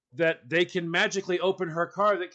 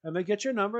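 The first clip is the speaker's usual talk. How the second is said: ordinary talk, nothing marked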